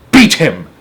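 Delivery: loud cry. Acted. Sponge urging Spiker to beat James, loud cry. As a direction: call out for a beating. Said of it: "cried Aunt Sponge".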